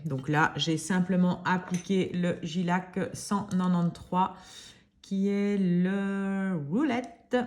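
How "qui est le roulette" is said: drawn-out, put-on English accent. Nail artist naming a polish shade, hammed-up English accent. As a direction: say it slowly in a playful English accent. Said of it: "avec mon super accent anglais".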